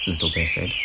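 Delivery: usual speaking voice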